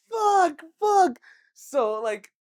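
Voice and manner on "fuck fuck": high, loud swearing; yelled curses in a panic; panicked cursing. Retold or imitated. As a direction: blurt it out in alarm. Panicking over the vomit.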